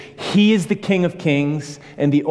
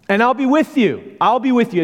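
emphatic promise; 10 semitones higher, 4 dB louder than usual. Acted. Voicing Jesus' promise to neighbors.

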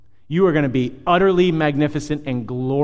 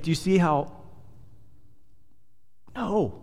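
ordinary talk

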